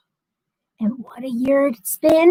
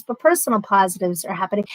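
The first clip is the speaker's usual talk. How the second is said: ordinary delivery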